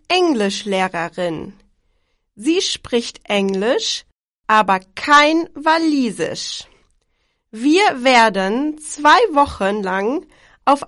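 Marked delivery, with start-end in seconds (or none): none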